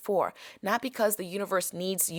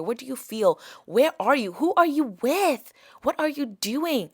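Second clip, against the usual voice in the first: ordinary talk, nothing marked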